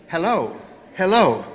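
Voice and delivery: loud singsong hello demo; demo greeting. Shown as a demonstration. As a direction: show the kids how to say it. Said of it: "This is how you say hello in Chinese".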